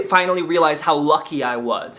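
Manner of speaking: ordinary talk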